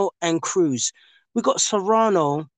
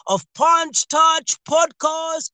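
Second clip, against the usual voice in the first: higher, drawn-out announcer welcome; 5 semitones higher than usual. Acted. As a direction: open the show like an announcer.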